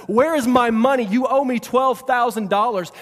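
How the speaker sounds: loud, high acted outburst; angry demand. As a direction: shout like a creditor grabbing a debtor by the throat. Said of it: "starts choking him and says".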